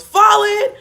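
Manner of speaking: loud, high-pitched shouted command to form up; drill command. Retold or imitated. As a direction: yell it like the company commander across the pad. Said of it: "company commander goes".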